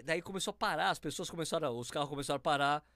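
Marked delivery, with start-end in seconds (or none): none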